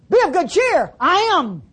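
lightly voiced Jesus, lifted high and ringing; calling out over the waves to calm them. Preacher voicing Jesus on water.

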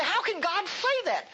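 high, slow, incredulous question; doubting listener. Retold or imitated. A doubting listener challenges the preacher, high and slow. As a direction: ask it in a high doubting squeak. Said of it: "You say".

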